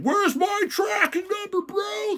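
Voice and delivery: very high, slow, drawn-out mock whine; mock annoying customer. Store owner mimicking an annoying guy after tracking, hammed-up whine. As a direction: imitate a pestering customer in a squeaky stretched voice. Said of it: "it's annoying is when some guy's like".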